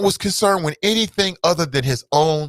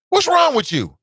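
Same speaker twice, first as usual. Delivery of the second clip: high outraged yell; 13 semitones higher than usual. Real, not acted. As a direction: shout it way up high in disbelief. Mock outrage.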